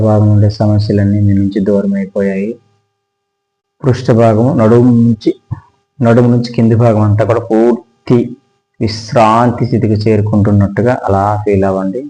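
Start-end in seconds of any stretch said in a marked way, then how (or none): none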